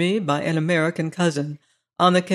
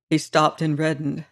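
usual speaking voice

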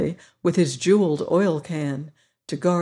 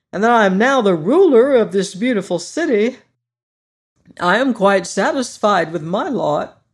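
Scarecrow voice; 3 semitones higher, 5 dB louder than usual. Acted. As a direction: play the proud new ruler, brighter and up. Scarecrow speaks in throne room.

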